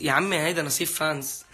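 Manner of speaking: ordinary delivery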